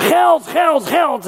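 hoog uitgeroepen als jongetje van zeven; kinderlijk roepen. Retold or imitated. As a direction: roep het hoog als een opgewonden kind. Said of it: "En dan riep ik". (jongetje dat hoog roept om geld)

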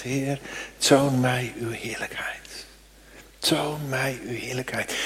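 an intimate, hushed plea, slow; whispered prayer. Acted. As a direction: whisper it to God in the tent.

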